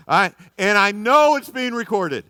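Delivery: real, high and very loud; loud exclamation. Real, not acted. Preacher joking about being recorded, loud and high.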